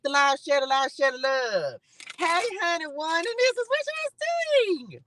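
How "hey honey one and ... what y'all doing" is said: sing-song hello. Real, not acted. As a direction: coo it up high to a favourite follower. Greeting a viewer by name.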